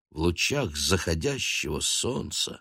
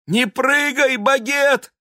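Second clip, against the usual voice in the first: much higher, louder cry from below; anguished cry; 6 dB louder, slower than usual. A narrator's reading. Murianna crying up from the yard, anguished. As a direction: scream up in alarm. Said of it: "воскликнула снизу Мурианна".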